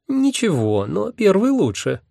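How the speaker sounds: put-on high, decided girl voice; girlish and final; categorical girl. A narrator's reading. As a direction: pronounce judgement like a picky maiden. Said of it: "безапелляционно заявила вторая голова".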